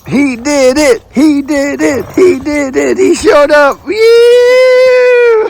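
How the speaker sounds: celebrating at the top of his voice; overjoyed cheer. Real, not acted. Greeting the late builder with a joyful high yell.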